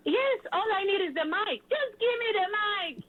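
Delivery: high, eager pitch for the stage; eager appeal. Acted. Host appealing to festival bookers for the mic, eager and high. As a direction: plead excitedly, voice lifted well up.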